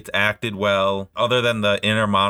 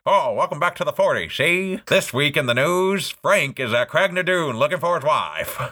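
put-on high-pitched old-time newsreel voice; newsreel announcer; 6 semitones higher than usual. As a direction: do a hammed-up nineteen-forties newsreel host. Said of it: "like, a newsreel".

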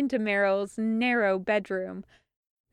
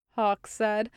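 ordinary delivery

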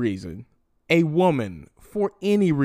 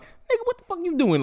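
much higher, incredulous demand; mock outrage; faster than usual. Imitating the podcast hosts grilling him, high outraged shriek. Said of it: "they were like".

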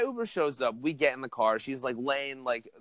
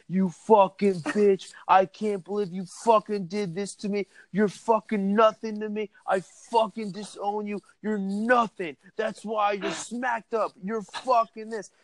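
put-on boyfriend ranting; 6 semitones higher than usual. Drunk boyfriend cursing his girlfriend over speakerphone, mock rant. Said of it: "He's like".

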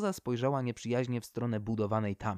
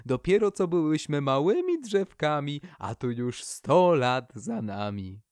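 excited birch; 2 semitones higher, 5 dB louder than usual. Acted. The birch gushes excitedly to the poplar, then introduces her sapling. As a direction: gush over an old friend you haven't seen in a century.

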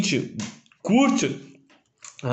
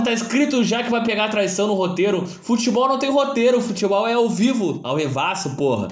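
normal talking voice

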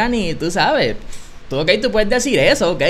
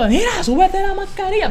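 mock yelling janitor; 11 semitones higher than usual. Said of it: "el tipo está gritando".

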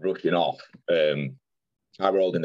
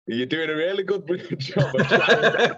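high, slow, laughing mock reassurance; laughing retelling; 12 semitones higher, 4 dB louder, slower than usual. Patient tactfully telling the paramedic it isn't working, laughing retelling. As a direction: draw it out high through laughter.

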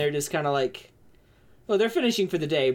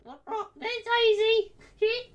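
tavern lady voice. Acted.

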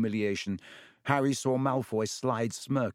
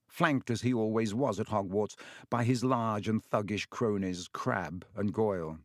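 ordinary delivery, nothing marked

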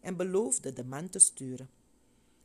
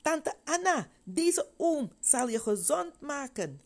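much higher, louder and slow, calling upstairs; a girl calling her aunt with delight; joyful call; 10 semitones higher, 5 dB louder than usual. A narrator's reading. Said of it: "en riep". (blij roepend naar tante Anna, hoog en luid)

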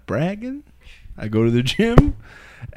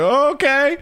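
taken aback; 11 semitones higher than usual. Retold or imitated. Trainer taken aback by outburst.

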